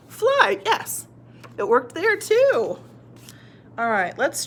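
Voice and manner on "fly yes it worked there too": high, pleased teacher cheer; excited discovery. Shown as a demonstration. Teacher finding the rule worked, excited. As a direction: announce success with a leap in pitch.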